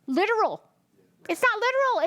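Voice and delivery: "not" pushed high the second time; insistent repeat, "not" lifted and hit; stress on not literal. Shown as a demonstration. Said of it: "repeats for emphasis".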